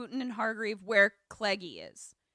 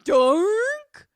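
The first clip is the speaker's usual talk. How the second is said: mock panic; 3 semitones higher, 9 dB louder, much slower than usual. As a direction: cry out in hammed-up alarm. Wallace's panic continues.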